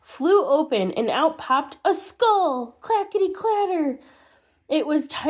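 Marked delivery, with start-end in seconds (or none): 2.8-4.0 high, rattling clackety clatter; clattering bones. Acted. Sound effect of falling skull.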